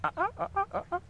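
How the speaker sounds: high, rapid goose hail call. Shown as a demonstration. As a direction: fire off high staccato ahs. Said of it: "So we've got the hail call".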